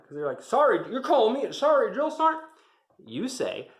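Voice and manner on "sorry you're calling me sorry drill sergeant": high, loud indignant yell; mocking drill sergeant. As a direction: throw the word back at the recruit, yelling.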